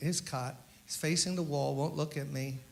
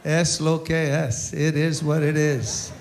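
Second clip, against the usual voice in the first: quoting Joe; 6 dB louder than usual. Retold or imitated. Quoting Joe's motto in Spanish.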